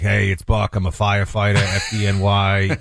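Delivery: low-pitched put-on New York accent; New York local accent. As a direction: talk like a Brooklyn local. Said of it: "when I'm in Sheepshead Bay".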